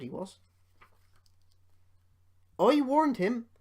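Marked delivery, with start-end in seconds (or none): 2.6-3.5 much higher, louder indignant retort; cross. A narrator's reading. Rusty complaining about Duncan, cross. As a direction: snap out the told-you-so. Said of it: "said Rusty crossly".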